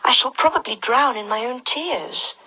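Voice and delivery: put-on high little girl voice, worried; Alice voice. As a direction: voice a fretful young girl.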